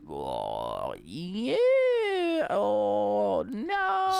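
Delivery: waver between yeah and no, stretching each sound; mock sceptical dithering. Acting out reaction to war talk.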